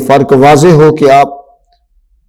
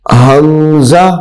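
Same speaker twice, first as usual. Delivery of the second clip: normal talking voice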